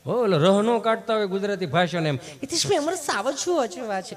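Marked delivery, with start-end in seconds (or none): 2.4-4.1 higher, overly careful polished Gujarati; prissy book-Gujarati impression; mock over-educated visitor. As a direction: ham up a city visitor showing off his refined speech. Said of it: "Gujarati આમ ચીપી ચીપીને બોલે".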